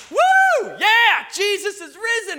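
high, loud whooping cheer; excited cheer. Retold or imitated. Churchgoers hearing Easter news, loud joyful whoop. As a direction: shout it like the best news ever. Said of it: "we're like".

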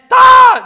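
high, very loud tantrum scream; imitated child's angry shriek; sudden full-volume meltdown yell; screaming fit. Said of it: "they throw a screaming, angry fit".

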